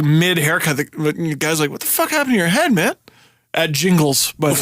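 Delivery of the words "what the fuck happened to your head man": quick, high-pitched disbelief; startled barber. Retold or imitated. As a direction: blurt it out as a shocked barber.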